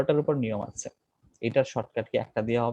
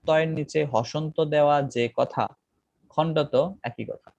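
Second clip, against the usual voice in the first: slow dictation; slower than usual. Shown as a demonstration. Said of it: "teacher repeating rule for emphasis".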